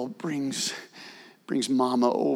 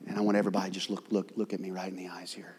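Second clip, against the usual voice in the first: fast, soft, whispered plea; urgent whisper; 9 semitones lower, 9 dB quieter, much faster than usual. Acted. Preacher asking the congregation to look at him, whispered. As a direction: pull them close in a whisper.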